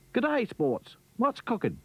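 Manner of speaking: high, bouncy Aussie voice; a cheery kangaroo exclaiming; Australian kangaroo. Acted. Kangaroo Rupert's voice.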